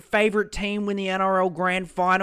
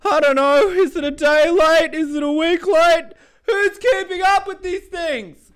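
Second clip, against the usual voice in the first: mock whiny rant; 10 semitones higher, 5 dB louder than usual. Mock exasperated high voice.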